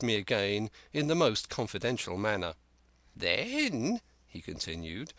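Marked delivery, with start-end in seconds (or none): 3.2-4.0 high, slow, cracked voice of a wild marooned sailor; an eager half-mad castaway, higher and drawn out; Ben Gunn. Acted. Marooned Ben Gunn eagerly pleading with young Jim, high and slow.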